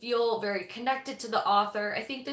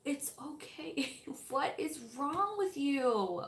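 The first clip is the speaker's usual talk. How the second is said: higher, hushed, slow cooing; mock soft baby talk; 4 semitones higher, 7 dB quieter, slower than usual. Husband cooing softly at the cat over cereal. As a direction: imitate him cooing at the cat. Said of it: "and then go".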